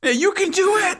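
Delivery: very high laughing cheer; squeaky high cheer. Pretend. Echoing the fisherman bit.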